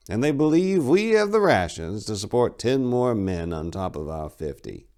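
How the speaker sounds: a hint of a worried elder; lightly voiced skeptical abbot. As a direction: abbot questioning the extra mouths. Abbot doubting rations.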